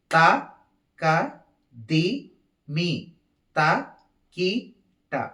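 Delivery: evenly spaced, detached syllables; counted rhythmic syllables. Shown as a demonstration. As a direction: mark each beat with one syllable. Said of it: "నేను ముందు నాలుగు అంటాను".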